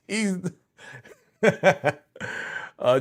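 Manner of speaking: ordinary talk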